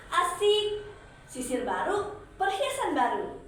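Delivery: high, loud squeal of delight; excited cheer. Acted. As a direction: celebrate like a greedy kid. Daughter delighted at shopping.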